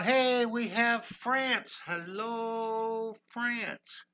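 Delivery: high, very slow, sung-out hello; singsong greeting. Real, not acted. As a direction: croon the welcome to France. Greeting a viewer from France.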